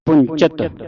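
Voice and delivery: ਪੁੰਚਤ with a clear ch sound; ਪੁੰਚਤ, ch not sh. Shown as a demonstration. Teacher demanding the ch sound in ਪੁੰਚਤ. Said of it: "ਚੱਚੇ ਦੀ ਆਵਾਜ਼ ਕੱਢਣੀ ਹੈ".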